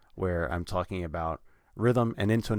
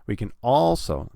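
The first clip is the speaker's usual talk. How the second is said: "also" loud and high on its first syllable; stress on also; 4 semitones higher, 6 dB louder than usual. Shown as a demonstration. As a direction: punch the AL of "also". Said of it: "it's the first syllable".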